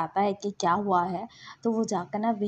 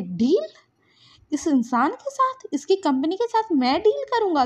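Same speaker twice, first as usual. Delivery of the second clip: higher, louder scornful questioning; indignant outburst; 4 semitones higher, 4 dB louder than usual. Acted. Wing refuses any deal with that man, indignant. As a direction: declare it with offended force.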